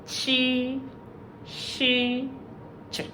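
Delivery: chi, she, ch said one by one; drawn-out sample sounds with clear gaps; isolated speech sounds. Shown as a demonstration. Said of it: "Such sounds as".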